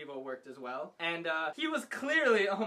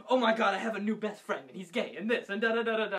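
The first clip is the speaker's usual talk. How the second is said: hammed-up breathless news to a friend; mock excited gushing; 3 semitones higher, 5 dB louder than usual. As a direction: gush louder, imitating an excited friend. Retold excited call to a friend.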